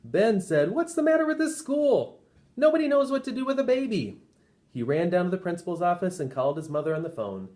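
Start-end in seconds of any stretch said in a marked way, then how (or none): 0.7-4.2 much higher outraged complaint; a kid fed up with grown-ups; high indignant whine-free outburst; exasperated boy. Acted. Ben exasperated at the school.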